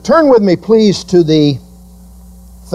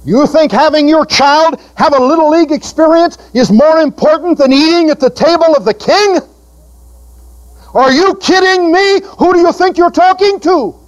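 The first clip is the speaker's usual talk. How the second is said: high, driving preacher's rebuke; preaching at full force; 11 semitones higher than usual. Acted. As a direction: press them hard from the pulpit.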